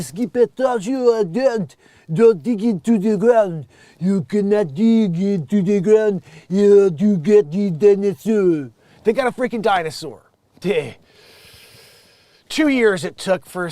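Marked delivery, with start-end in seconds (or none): 0.0-8.7 slow, loud mock French accent, drawn out; a Frenchman scolding his dog in a thick French accent. Scolding the dog Skip, in a hammed-up French accent. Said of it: "the French accent".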